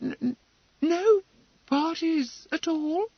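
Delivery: high, stammering question; dismayed. Acted. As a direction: falter it out in dismay. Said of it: "Emily's eyes darkened in dismay".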